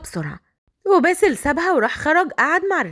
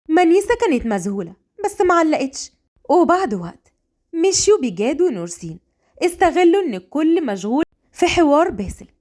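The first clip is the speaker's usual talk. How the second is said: ordinary delivery, nothing marked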